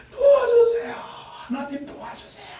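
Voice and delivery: very high, loud crying shout; desperate cry for help. Shown as a demonstration. Preacher showing how to cry out to God for help. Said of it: "이렇게".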